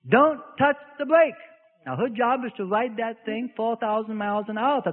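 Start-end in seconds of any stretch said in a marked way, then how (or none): 0.1-1.3 higher, louder command; mock stern husband; firm order. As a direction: lay down the law.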